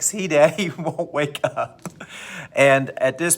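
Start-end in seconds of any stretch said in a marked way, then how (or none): 0.0-1.8 laughing through the quote. Retold or imitated. Dad quoting son over dead cat.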